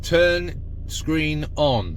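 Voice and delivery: clear voice command. Acted. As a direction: spell every word out slowly for the machine. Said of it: "my more clearer telephone video voice".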